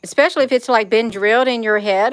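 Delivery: normal talking voice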